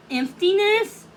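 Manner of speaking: ordinary delivery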